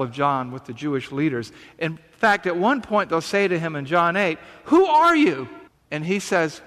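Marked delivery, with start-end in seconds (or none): 4.6-5.5 loud, high-pitched challenge; incredulous raised-voice question; outraged demand. Retold or imitated. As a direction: demand an answer, exasperated and loud. Acted challenge to Jesus from opponents.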